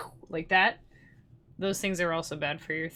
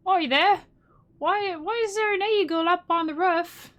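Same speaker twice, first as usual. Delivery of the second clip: high, loud bad British accent; 11 semitones higher, 4 dB louder than usual. Mocking UK folks, loud bad British accent. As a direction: ham up a British bloke shouting. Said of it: "I can't do accents very well".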